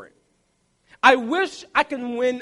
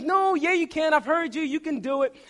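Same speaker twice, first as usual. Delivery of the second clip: higher-pitched eager coaxing, imitated; encouraging friend; 6 semitones higher than usual. Christine coaxing him to sing along, encouraging. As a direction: mimic a friend cheering you on.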